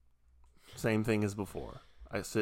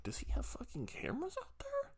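high, breathy whispered alarm; panicked whisper; 14 dB quieter than usual. Retold or imitated. Teller whispering his paranoid thought about cameras. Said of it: "I'm like".

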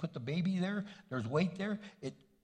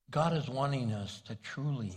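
ordinary delivery

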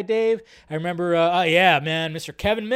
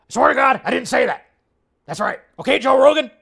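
loud, raised ranting host voice; hammed-up shouted impression; Alex Jones; 6 semitones higher, 9 dB louder than usual. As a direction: protest like an outraged conspiracy broadcaster. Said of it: "There's my, uh, Alex Jones impression".

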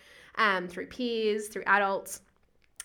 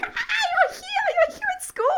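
squealed much higher, bursting with joy; excited squeal at seven-year-olds arriving at school; squealing excitement; 6 dB louder than usual. Retold or imitated. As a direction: squeal it like greeting a long-lost friend. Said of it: "I'm like".

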